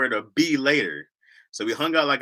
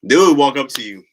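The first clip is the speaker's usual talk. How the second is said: normal talking voice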